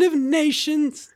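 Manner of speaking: high, slow, loud English stateswoman; Margaret Thatcher. Acted. Dad performs his impersonation on request, high slow stateswoman voice. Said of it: "Oh, and Margaret Thatcher".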